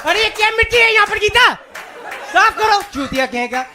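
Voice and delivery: high, very loud barked orders; bossy husband shouting. Retold or imitated. Husband ordering Gita to make chai and clean, shouting. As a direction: holler for Gita across the house.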